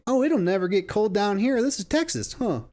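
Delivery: mock naive certainty; mocking quote. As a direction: scoff at their overconfidence.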